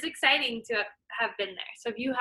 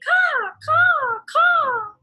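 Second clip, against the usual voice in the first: much higher, louder crow call; crow cawing; 10 dB louder, much slower than usual. Acted. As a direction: caw loud like an alarm. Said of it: "He flew into the forest crying".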